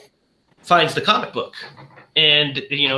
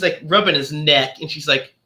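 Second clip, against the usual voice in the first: usual speaking voice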